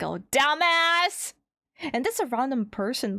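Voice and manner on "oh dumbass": mock greeting. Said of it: "they're like".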